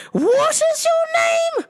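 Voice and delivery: slow, shrieked question in fake English; exasperated high mock-English shout; phony English accent. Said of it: "phony English accent".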